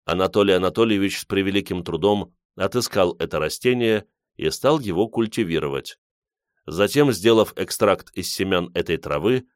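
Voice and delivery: plain reading voice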